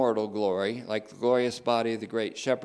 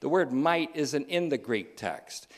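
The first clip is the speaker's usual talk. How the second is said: ordinary delivery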